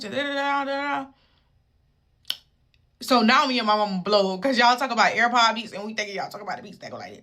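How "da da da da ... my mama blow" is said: ordinary talk, nothing marked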